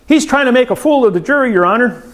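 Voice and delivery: high, fast mock complaint to the judge; a hint of a flustered prosecutor tattling; indignant protest.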